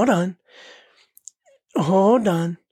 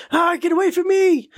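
panicked yell; 10 semitones higher than usual. Retold or imitated. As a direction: shriek it like a terrified kid. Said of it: "He's like".

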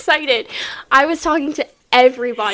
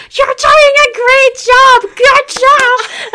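high, slow screamed cheering; screaming cheer; 11 semitones higher, 8 dB louder, much slower than usual. Retold or imitated. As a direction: yell it like a wild fan. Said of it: "screaming at the top of my lungs".

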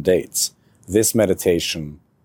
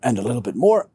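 talking mid-breath; 8 semitones higher than usual. Shown as a demonstration. Demonstrates breathing while lungs are full.

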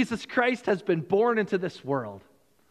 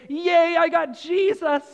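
put-on excited child; 9 semitones higher, 5 dB louder than usual. Child opening Christmas present.

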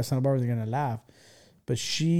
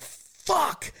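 sudden high-pitched yelp of dismay; a kid panicking when his nickname is yelled; startled curse. Retold or imitated. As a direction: yelp it when you hear your name. Reacting to being called out.

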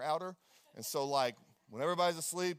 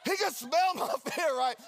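laughing; 8 semitones higher, 4 dB louder than usual. Real, not acted. Laughing while telling.

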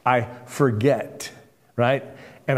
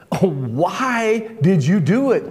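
long, drawn-out wail, pitched higher; groaning; 5 semitones higher, 4 dB louder, slower than usual. A narrator's reading. Terrified sailors reproaching Jonah for running away, groaning. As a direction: groan it out slowly in dismay. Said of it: "they groaned".

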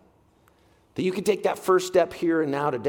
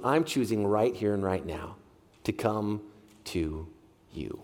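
fading prayer; 9 semitones lower, 6 dB quieter, slower than usual. Retold or imitated. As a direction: lower and softer down to a hush.

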